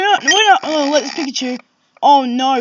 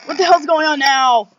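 ordinary talk

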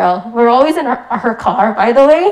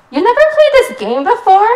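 put-on high little-girl voice, louder; child voice; 12 semitones higher, 4 dB louder than usual. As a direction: mimic a nine-year-old cousin.

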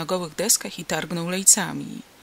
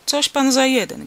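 loud, higher-pitched angry demand; indignant farmer, voice raised; angry question; 7 semitones higher, 7 dB louder than usual. A narrator's reading. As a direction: challenge a stranger angrily. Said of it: "gniewnie zapytał chłop".

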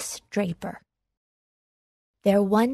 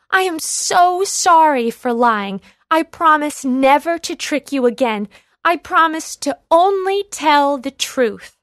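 higher, louder pleading apology; 6 semitones higher, 5 dB louder than usual. A narrator's reading. Boy apologising to villagers, pleading. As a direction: beg them to believe you. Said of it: "pleaded the boy".

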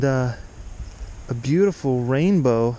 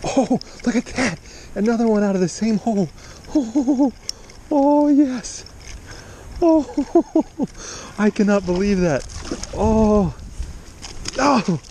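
real excitement; 7 semitones higher than usual. Real, not acted. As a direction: yelp with real joy as the fish comes in. Catching another trout from same hole.

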